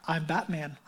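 low, hushed superhero voice; Batman. Acted. Preacher quoting the hero's famous line, low quiet Batman voice. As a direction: drop it low and quiet, like a masked vigilante. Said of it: "way better Batman voice than I can".

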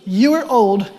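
loud, pointed, blunt delivery; loud mock-rude elders; blunt loud reading. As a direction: hammer the insult home. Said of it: "That's also not offensive".

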